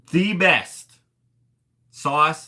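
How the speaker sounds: loud, high, emphatic verdict; emphatic rave. Real, not acted. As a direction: proclaim it like a big revelation. Raves about a favourite sauce.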